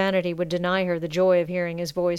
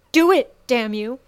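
louder, sharp demand; angry demand; 4 semitones higher, 7 dB louder than usual. A narrator's reading. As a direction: push him to do it, furious. Sasha demanding he act.

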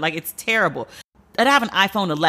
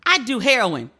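ordinary delivery

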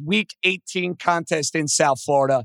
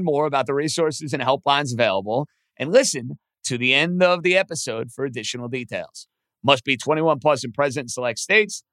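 ad read; 5 semitones lower than usual. Acted. Host reads sponsor ad.

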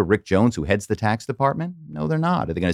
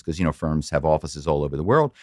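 ordinary talk, nothing marked

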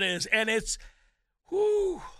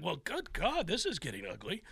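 flustered mutter; 4 semitones lower, 10 dB quieter than usual. Retold or imitated. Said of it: "poor Jim's over here, flustered".

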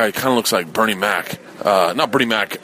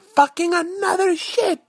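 mock outrage; slower than usual. Imagined crew reaction.

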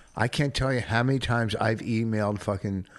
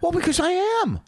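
cocky; 3 dB louder than usual. Pretend. Mock boast after accusation.

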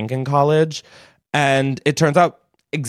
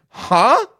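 baffled huh; 10 semitones higher, 5 dB louder than usual. Retold or imitated. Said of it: "And I was like".

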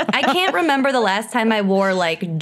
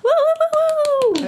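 cheering woo. Real, not acted. Cheering the guest's arrival.

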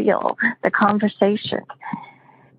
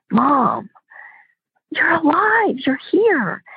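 shocked; 7 semitones higher, slower than usual. Retold or imitated. Dreamer to her late mother, crying out in shock. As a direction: voice jumping up in disbelief. Shocked to see dead mom.

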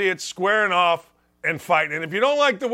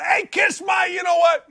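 retold yell; 9 semitones higher than usual. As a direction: yell it out the bus window at the crowd. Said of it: "I yell out".

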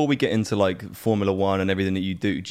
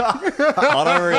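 put-on high, slow Australian accent; 12 semitones higher, 4 dB louder, slower than usual. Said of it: "in the Australian accent".

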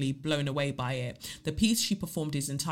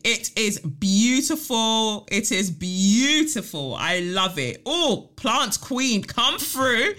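high, drawn-out delight; excited gushing; 7 semitones higher, 4 dB louder, much slower than usual. Real, not acted. Host cheering a listener's new lounge, gushing. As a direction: hype her up with long, loud, rising words.

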